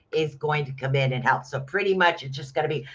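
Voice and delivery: ordinary talk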